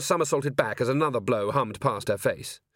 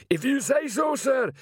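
high, prompt acknowledgement; troll on duty, pitched way up; troll sergeant; 14 semitones higher than usual. A narrator's reading. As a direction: answer like a troll reporting for orders. Troll answers captain's order.

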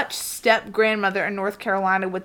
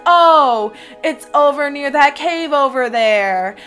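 loud, higher, hammed-up helpful ghost; misleading witch; 4 semitones higher, 8 dB louder, slower than usual. Witch sending searchers off to the cave, mock helpfulness. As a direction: play up a false sudden realization. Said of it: "And she'd be like".

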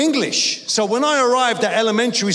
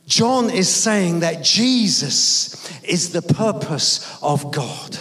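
ordinary talk

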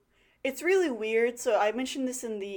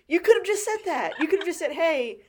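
high, louder, incredulous telling-off; exasperated rebuke; 7 semitones higher, 5 dB louder than usual. Acted. Viewer telling off the profiler for screaming, exasperated. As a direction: start high in exasperation, then ease down.

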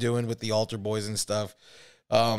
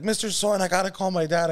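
put-on higher, urgent plea of a scared boy; panicked kid; 6 semitones higher than usual. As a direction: beg to use the phone, frantic. Panicked kid begging shopkeeper.

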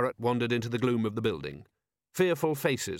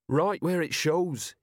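slow, higher-pitched grumble; complaining; 6 semitones higher, slower than usual. A narrator's reading. Constable showing his damaged breastplate, complaining. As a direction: draw it out as a grievance. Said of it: "he complained".

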